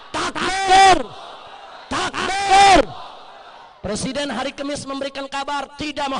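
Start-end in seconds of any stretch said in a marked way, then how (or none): 0.1-2.8 loud, high takbir cry; rallying shout. Real, not acted. Orator calls the rally to answer with takbir, rallying shout. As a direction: shout it out to rouse the whole crowd.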